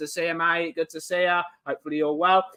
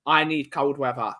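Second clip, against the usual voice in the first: ordinary talk, nothing marked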